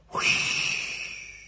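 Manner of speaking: long, airy wind whoosh; breath-only gust. A narrator's reading. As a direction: blow out a fading whoosh of wind. Narrator imitates the wind.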